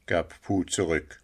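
usual speaking voice

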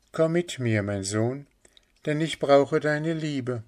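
high, gently imploring woman's voice; pleading mother. Acted. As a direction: beg a lost son tenderly. (flehende Mutter, hohe Stimme)

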